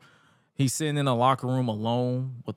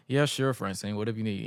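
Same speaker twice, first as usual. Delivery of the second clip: quiet, lowered, unbothered reply; calm tone; 4 semitones lower, 4 dB quieter than usual. Retold or imitated. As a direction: answer as if nothing had happened. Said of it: "in the most calm tone ever".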